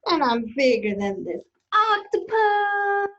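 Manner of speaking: high-pitched crowing brag; boastful squid. Acted. Reader as squid outdoing the octopus, boastful. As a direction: crow it with a long held final word. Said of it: "he's very boastful".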